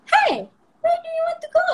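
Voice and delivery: very high, loud mimicry of cartoon dialogue; high-pitched echo. Mother imitating her child echoing cartoon dialogue, high pitch. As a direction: copy the line in a squeaky high pitch. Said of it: "high pitch lah".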